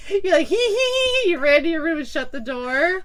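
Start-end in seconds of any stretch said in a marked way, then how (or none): 0.5-1.3 put-on high hee-hee giggling like a little kid; childish giggle. Drunk friend giggling down the stairwell, childlike giggle. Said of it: "You were like a little kid".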